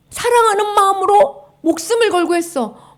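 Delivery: usual speaking voice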